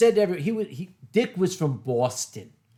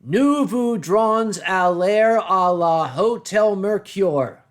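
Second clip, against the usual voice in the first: put-on slow, loud French with a Boston American accent; the boss mangling his French, loud and slow in a Boston accent; bad American French; 4 semitones higher, 8 dB louder, slower than usual. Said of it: "Dick leaned forward and said".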